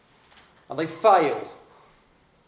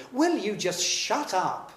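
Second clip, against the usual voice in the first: stern; 8 semitones higher, slower than usual. Acted. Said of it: "said Jesus sternly".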